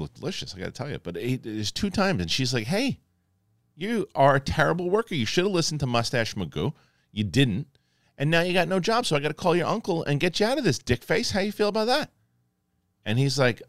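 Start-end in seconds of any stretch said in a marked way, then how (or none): 2.7-3.0 high-pitched scolding mom; a high, exasperated "hey" from a mother; nagging mother. Acted. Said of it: "the mother with the smoker's accent". 3.8-12.1 high, scolding mom impression; nagging mother. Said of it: "the mother with the smoker's accent".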